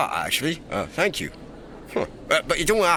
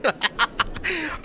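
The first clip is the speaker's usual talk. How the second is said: high burst of laughter; gleeful high giggle; teasing laughter; 13 semitones higher than usual. Acted. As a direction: crack up at your own prank. Laughs at teased attendant.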